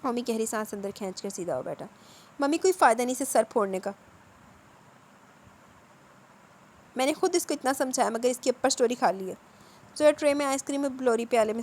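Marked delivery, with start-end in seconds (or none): 2.4-9.3 louder, calling out as she walks in. A narrator's reading. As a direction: call across the room, cheerfully loud. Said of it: "اونچی آواز میں بولتی چلی آئی".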